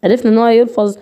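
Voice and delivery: ordinary talk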